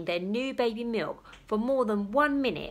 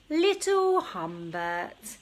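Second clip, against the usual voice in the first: fond mother; slower than usual. Acted. Mother names baby.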